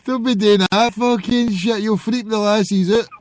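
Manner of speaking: ordinary talk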